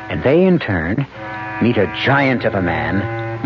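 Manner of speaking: ordinary talk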